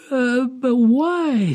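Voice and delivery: high, slow, faltering question; falter, then draw it out in bewilderment; stammering. A narrator's reading. Young woman questions the children's welcome, stammering. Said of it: "stammered the young woman".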